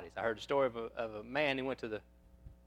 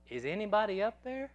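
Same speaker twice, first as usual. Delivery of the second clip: imitated small child, high rising question; frail little voice; 8 semitones higher than usual. Son calling up to his parents' room, frail little voice. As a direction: pitch it way up like a lonely little boy. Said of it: "I heard a frail little voice".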